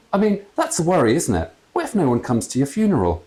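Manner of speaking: lightly voiced jolly dad; chatty ghost father. A narrator's reading. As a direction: natter on like a jolly old man.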